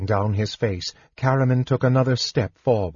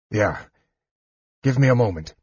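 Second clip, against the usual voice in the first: grunted, effortful reply; faster than usual. A narrator's reading. Wounded warrior asking his brother for time, grunting. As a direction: grunt it out through weakness. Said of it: "Caramon grunted".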